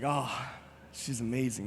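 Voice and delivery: a lovestruck, hushed sigh of words; dreamy hush. Acted. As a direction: breathe it out, dazzled. Adam dazzled by Eve.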